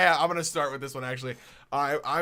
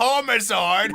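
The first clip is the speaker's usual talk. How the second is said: mock shock; 6 semitones higher, 10 dB louder, slower than usual.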